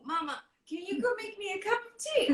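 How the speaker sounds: high, stammering embarrassed father; awkward dad. Retold or imitated. Dad dodging a love scene by asking for tea, awkward stammer. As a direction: hem and haw to escape the scene. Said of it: "he will say".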